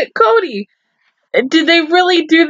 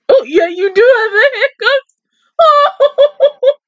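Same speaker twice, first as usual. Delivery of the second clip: laughing; 12 semitones higher than usual. Real, not acted. Laughing at dog's hiccups.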